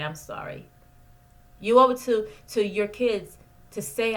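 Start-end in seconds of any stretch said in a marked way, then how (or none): 0.0-0.7 softer and whispered "I'm sorry"; whispered apology. Shown as a demonstration. Therapist modeling a parent's apology to a child, whispered. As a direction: breathe "I'm sorry" like a hushed, sincere apology.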